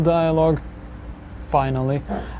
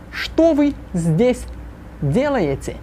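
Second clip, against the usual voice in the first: slow, clearly spaced model question; slow repeat-after-me; 6 semitones higher, slower than usual. Shown as a demonstration. Teacher slows the question so students can repeat it. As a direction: draw each word out for the class. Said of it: "this painfully slowly".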